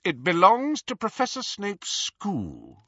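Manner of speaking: high, slow supercilious portrait; haughty correction. A narrator's reading. Portrait correcting Harry about the sword, haughty. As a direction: stretch it out with pompous insistence.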